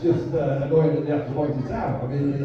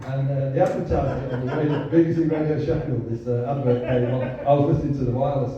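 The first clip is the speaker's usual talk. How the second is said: ordinary talk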